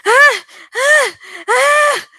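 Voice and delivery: very high, loud, rising screams; frantic screaming. Acted. Woman keeps screaming at the strange boys, frantic high screams. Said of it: "She kept screaming".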